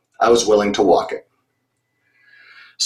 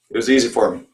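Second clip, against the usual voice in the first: ordinary delivery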